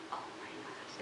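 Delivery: unvoiced mortified whisper. Girl whispering when her dress fails on stage. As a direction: whisper it in embarrassment. Jenna's embarrassed reaction.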